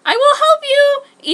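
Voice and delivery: slow, very high and loud scream. Acted. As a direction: shout it out to rescue him. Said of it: "Ichigo screamed".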